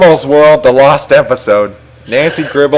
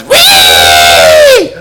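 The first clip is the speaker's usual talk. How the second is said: loud, drawn-out fake bawl; exaggerated cartoon cry, held long; mock crying wail; 7 semitones lower, 6 dB louder than usual. A narrator's reading. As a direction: wail like a child in a hammed-up tantrum. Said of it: "And Bobby cries".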